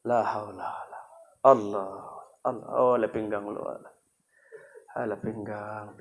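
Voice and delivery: weak, low, moaning sick husband; sick man groaning. Acted. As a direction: groan like a man in pain from his back. (suami sakit merintih lemah)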